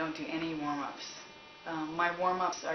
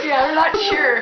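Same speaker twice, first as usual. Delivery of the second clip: slow laughing words through giggles; laughing agreement; 11 semitones higher, 19 dB louder, slower than usual. Real, not acted. Joking about her roving accent, laughing. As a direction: laugh it out, unsure. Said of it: "roving European accent".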